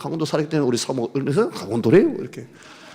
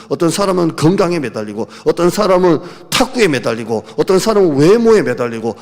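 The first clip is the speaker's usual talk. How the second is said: loud, driving sermon delivery; fiery preaching; 2 semitones higher, 9 dB louder than usual. Acted. Pastor pressing his congregation, loud and driving. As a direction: hammer the list home to the congregation.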